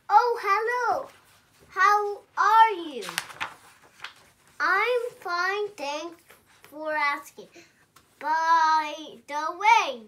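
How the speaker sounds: loud, chatty bird voice with a sly ask; butter them up, then spring the question; pushy pigeon. Acted. Pigeon greeting the reader, loud and cheeky. Reading the pigeon's lines aloud.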